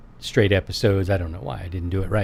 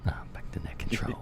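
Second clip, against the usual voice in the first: whispered echo of the announcer drop; whispering; 12 dB quieter, faster than usual. Pretend. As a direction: repeat the line under your breath. Echoing the radio drop.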